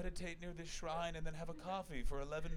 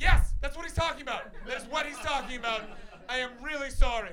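flustered apology; 8 semitones higher, 10 dB louder than usual. Retold or imitated. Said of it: "And I was like".

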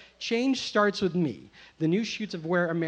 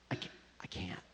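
nervous whispered refusal; 10 semitones lower, 14 dB quieter, faster than usual. Retold or imitated. Narrator refusing to hide the bag, whispered. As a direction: whisper it so nobody on the bus hears.

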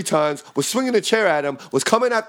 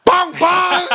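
loud, much higher shouted bang; 7 dB louder than usual. Retold or imitated. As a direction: belt out the impact noise. Retelling the kicks.

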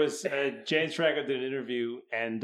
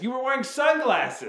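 higher, louder, slow disbelief; incredulous comeback; 10 semitones higher, 6 dB louder than usual. Retold or imitated. Franco telling Tommy he wore sunglasses, higher and incredulous. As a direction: drag it out in amused disbelief.

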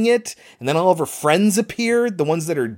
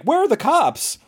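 high-pitched imitation of a baffled woman; mock indignant demand; 10 semitones higher than usual. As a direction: swoop high on ARE, then fall. Repeats the woman's demand, now exaggerated.

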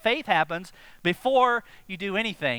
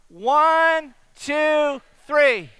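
higher, loud drawn-out count; loud group count; 6 semitones higher, 7 dB louder, much slower than usual. Real, not acted. Leading everybody in the count before the trust fall, loud count. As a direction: call out each number for everyone.

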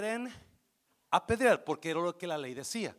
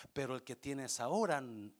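usual speaking voice

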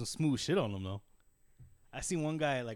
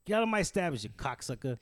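New York accent, raised then dropping on the insult; New Yorker; 3 semitones higher than usual. Shown as a demonstration. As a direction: kick them out like a mock New Yorker. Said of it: "I could do a New Yorker".

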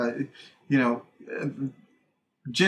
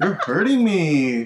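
louder, slow, laughing whine; whiny big baby; 5 semitones higher, 6 dB louder, slower than usual. Retold or imitated. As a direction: whimper like a big baby with man flu. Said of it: "he's being such a baby".

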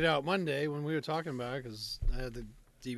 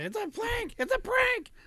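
high-pitched mock yell, building louder; imitated yelling wife; 6 dB louder, slower than usual. Wife calling out as dad chases kid, mock frantic yelling. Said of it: "The wife's yelling".

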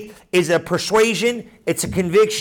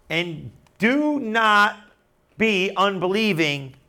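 very slow, drawn-out scripture quote; slow and measured, letting each phrase land; slow, deliberate; slower than usual. Retold or imitated. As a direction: stretch the words out, pausing between phrases. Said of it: "Now just go slow".

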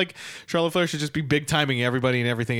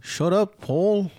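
higher mock Scouse retort; playfully annoyed Liverpudlian imitation; 6 semitones higher, much slower than usual. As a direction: snap back in a put-on Liverpool accent. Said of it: "This is [name]".

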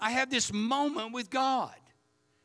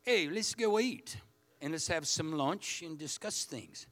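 put-on measured, refined British accent, softening as it goes; smart-sounding British speaker inviting someone to lunch; 5 semitones lower, 5 dB quieter than usual. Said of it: "how British people sound smarter than Oklahoma people".

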